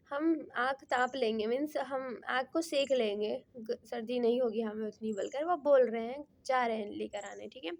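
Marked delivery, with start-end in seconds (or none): none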